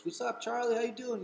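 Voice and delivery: high, loud excited hello; excited greeting. Retold or imitated. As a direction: call out to him like a thrilled fan.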